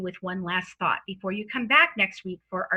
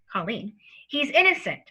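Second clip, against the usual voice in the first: usual speaking voice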